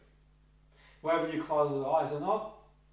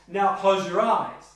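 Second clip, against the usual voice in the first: loud, slow firm order; directive command; 3 semitones higher, 8 dB louder, slower than usual. Shown as a demonstration. Therapist telling client to close eyes, loud and firm. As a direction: tell them firmly, no room to refuse. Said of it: "if you say to your client".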